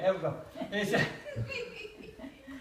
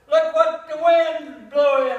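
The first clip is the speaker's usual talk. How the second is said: loud, slow jeer, a bit higher; loud mocking call; 5 semitones higher, 7 dB louder, slower than usual. A recruit jeering at the new arrival, loud and slow. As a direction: call it out across the room to tease.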